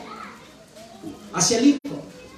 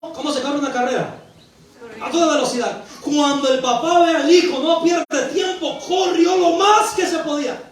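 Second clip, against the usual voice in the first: raised, emphatic sermon delivery; impassioned preaching; 6 semitones higher, 3 dB louder than usual. Real, not acted. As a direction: drive each point home to the congregation. Preaches at full force. (predicación enérgica y apasionada)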